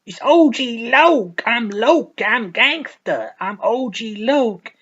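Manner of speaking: loud, high, slowed boasting; shouting his own name with swagger; braggy rapper hype. Retold or imitated. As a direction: hype yourself up like a wannabe gangster. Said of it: "And you're like".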